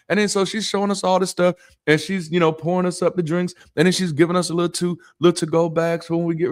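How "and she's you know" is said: ordinary delivery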